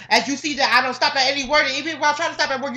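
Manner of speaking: rapid-fire boasting; fast showoff reading. Shown as a demonstration. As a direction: rattle it off fast to prove a point. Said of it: "you see how fast I can read".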